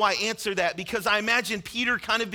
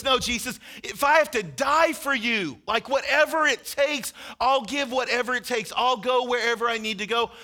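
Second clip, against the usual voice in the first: higher and louder, pleading; fervent vow; 4 semitones higher, 4 dB louder than usual. Acted. Peter swearing to Jesus he will die for him, higher and louder. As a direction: swear it with everything.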